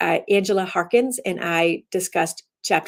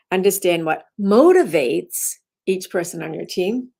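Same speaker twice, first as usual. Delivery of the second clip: "motivates" hit with a rising lift; stress on motivates. Shown as a demonstration. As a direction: make "motivates" the word that matters. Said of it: "you emphasize it to change the meaning".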